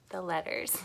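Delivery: playful whispered secret. Acted. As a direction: lean in and whisper to the kids. Whispered aside about Buster.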